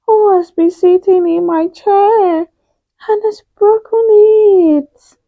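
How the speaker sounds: very high, tearful little bear voice; Baby Bear near tears over his chair; very tiny little voice. Acted. Baby Bear finding his broken chair, high and crying. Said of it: "asked in a very tiny little voice".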